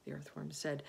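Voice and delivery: ordinary delivery, nothing marked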